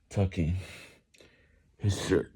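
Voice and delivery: ordinary delivery, nothing marked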